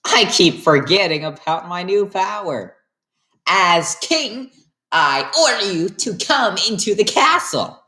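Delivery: hammed-up king command. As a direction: order them in grandly.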